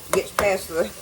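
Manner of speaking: ordinary talk, nothing marked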